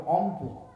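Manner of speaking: অংপু with the র dropped; mild imitation of Rangpur speech; Rangpur accent. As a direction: say রংপুর as অংপু, no র. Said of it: "র উচ্চারণ করতে পারে না".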